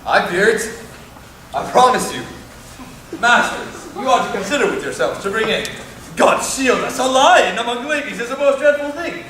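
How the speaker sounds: alarmed warning. Acted. Frets about lion scaring ladies.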